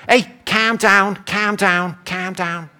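mock Liverpool catchphrase, repeated and fading; Liverpudlian accent. Shown as a demonstration. Liverpudlians wanting you to be calm, in Scouse accent. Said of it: "They go".

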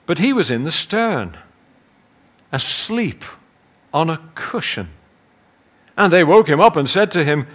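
measured, solemn Bible reading; scripture reading. Acted. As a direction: read the passage like a preacher at the lectern. Reads the gospel aloud.

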